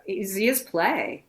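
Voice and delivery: slow, put-on Eastern European accent; flirty character in a made-up Eastern European accent. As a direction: improvise a comic Eastern European accent, drawn out. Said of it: "You came up with the accent".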